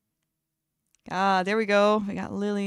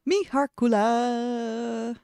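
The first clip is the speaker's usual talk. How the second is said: drawn-out greeting; 3 dB louder, much slower than usual. Real, not acted.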